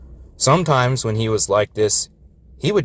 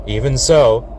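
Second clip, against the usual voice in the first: usual speaking voice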